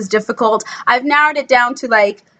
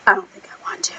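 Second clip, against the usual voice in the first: soft whispered retold reply; menacing whisper; 7 dB quieter, faster than usual. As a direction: whisper the character's refusal. Voicing Credence's reply.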